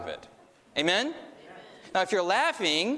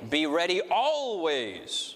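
always drawn out slow and falling; slow delivery landing on always; stress on always; much slower than usual. Shown as a demonstration. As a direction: lean into the final word always. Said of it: "emphasize each word every time".

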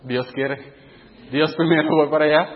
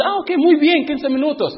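a sudden high surprised okay; surprised agreement; 10 semitones higher than usual. Retold or imitated. (sorpresa con voz muy aguda)

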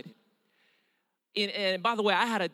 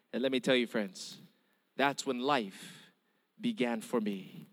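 tender, slow; 9 semitones lower, 6 dB quieter than usual. Real, not acted. Climax of conversion testimony.

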